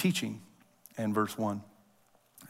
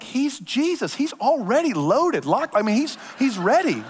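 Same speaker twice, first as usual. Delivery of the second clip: much higher, playful hyped-up retort; high teasing mock bravado; mock hype voice; 10 semitones higher than usual. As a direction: pitch way up like a cocky fan. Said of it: "I'm like".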